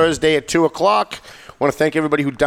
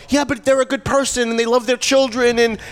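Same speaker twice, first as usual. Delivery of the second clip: high, whiny mock voice; mocking imitation; 8 semitones higher than usual. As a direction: parody people qualifying. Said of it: "people are always like".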